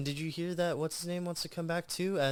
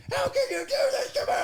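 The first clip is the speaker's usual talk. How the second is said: much higher, louder wailing cry; crying shout; 6 dB louder, faster than usual. Retold or imitated. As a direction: wail it out, heartbroken and sobbing. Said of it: "And he's, like, crying".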